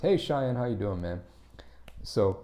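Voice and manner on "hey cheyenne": usual speaking voice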